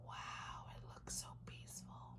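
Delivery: hushed unvoiced whisper. Real, not acted. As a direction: breathe it in awe. Reader whispers about pond picture.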